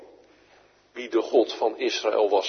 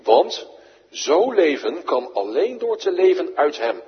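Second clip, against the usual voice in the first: ordinary talk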